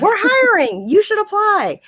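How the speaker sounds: high, loud mock recruiter pitch; over-eager recruiting. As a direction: call it out high, like a hammed-up recruiter. Quoting dispatchers' recruiting pitch.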